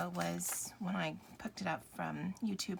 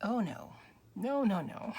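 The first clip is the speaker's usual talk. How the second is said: ordinary talk, nothing marked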